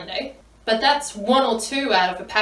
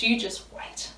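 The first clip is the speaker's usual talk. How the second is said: normal talking voice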